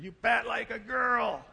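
slow, high, loud jeer; mocking taunt. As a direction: taunt him like a teammate. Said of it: "I hear words like geek and jerk".

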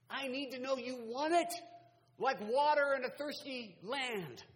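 God's demand rung out from the pulpit; forceful preaching. Acted. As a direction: proclaim it high and loud.

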